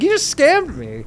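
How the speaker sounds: high, slow disbelief; incredulous retort. Retold or imitated. Telling the scammer he just scammed him, high incredulous. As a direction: say it as if it's obvious.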